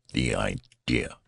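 usual speaking voice

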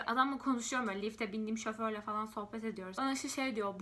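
normal talking voice

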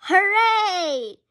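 high, loud hooray; loud happy cheer. Acted. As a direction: cheer with joy. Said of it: "George cheers".

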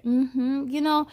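plain reading voice

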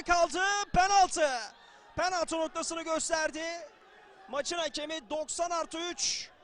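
loud penalty call; shouting commentator. Real, not acted.

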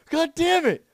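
high, very loud frightened yell; screamed curse. Real, not acted. Player just jump-scared, screamed curse. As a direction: scream it in shock.

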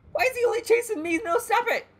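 high loud panicked whine; panicking gamer. Real, not acted. Only one chased.

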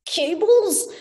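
high, slow robot voice stammering in jolts; timid robot begging, slow and high with stutters; stuttering robot. Acted. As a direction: plead like a glitching machine that stutters. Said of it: "The bot's flat voice came in jolts and starts".

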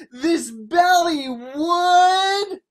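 high, loud, stretched-out words; building suspense. A narrator's reading. Hero growing inside the belly, slow swelling suspense. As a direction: draw it out towards the burst.

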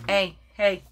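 normal talking voice

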